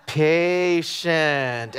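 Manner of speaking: "patient" stretched out extremely slowly, like mom; five-second word. Retold or imitated. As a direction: draw out each syllable of "Be patient". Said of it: "like, a five-second word".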